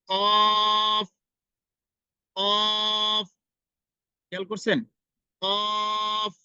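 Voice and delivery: teacher contrasting qaf with kaf; thick qaf. Shown as a demonstration. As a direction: make "কফ" thick and round. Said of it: "কফের উচ্চারণটা একটু মোটা".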